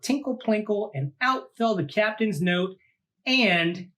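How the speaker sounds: lively read-aloud storytelling voice; storybook narration. A narrator's reading. Host reading a monster picture book to kids, lively storytelling. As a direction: read it out like to a child at bedtime.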